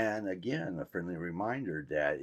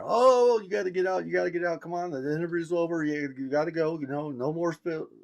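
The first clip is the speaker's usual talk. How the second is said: urgent shooing aide; 10 semitones higher, 5 dB louder than usual. Retold or imitated.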